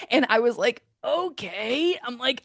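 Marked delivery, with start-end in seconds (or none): 1.0-2.0 mock-grudging acceptance, pitched up; reluctant agreement. Hiker recalling the pricey gear list, reluctantly agreeing.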